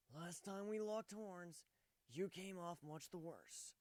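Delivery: high, slow, flat warning voice; a cool, unimpressed reminder; flat Shining Armor. Acted. Shining Armor flatly warns Warren off, slow. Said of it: "Shining Armor reminded flatly".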